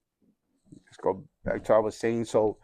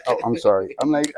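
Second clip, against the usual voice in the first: normal talking voice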